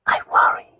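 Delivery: small, forlorn confession on the breath; wife's whispered, downcast admission. A narrator's reading. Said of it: "whispered in a forlorn voice".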